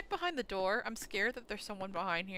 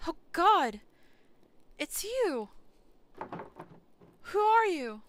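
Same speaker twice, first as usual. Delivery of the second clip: loud and much higher; alarmed shout; 9 semitones higher, 9 dB louder, slower than usual. Real, not acted. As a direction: yell it as if confronted. Reacting to scene.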